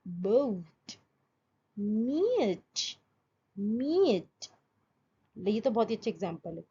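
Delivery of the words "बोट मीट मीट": stretched glide in बोट and मीट; diphthong demo. Shown as a demonstration. Teacher modelling gliding vowels, drawn out. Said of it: "शुरू हुआ ओ से".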